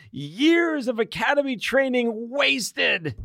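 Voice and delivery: high, slow, hammed-up space ranger lament; Buzz Lightyear despair. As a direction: wail it like a crushed toy hero. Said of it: "he lurches into these".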